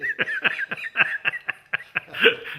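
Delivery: high loud burst of laughter. Real, not acted.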